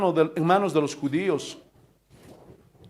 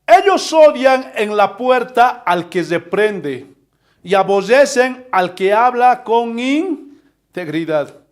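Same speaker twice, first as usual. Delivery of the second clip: slow, louder, weighty reading of the verse; solemn scripture reading; 3 semitones higher, 6 dB louder, slower than usual. Acted. Pastor reading Amos to his church, slow and solemn. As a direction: read the prophecy slowly, as a proclamation. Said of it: "Miren lo que dice".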